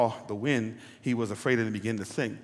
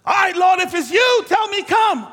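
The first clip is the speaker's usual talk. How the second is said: high, loud shouted plea; acted Peter shouting; 10 dB louder than usual. Peter shouting to Jesus for the call. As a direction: yell it out over the storm.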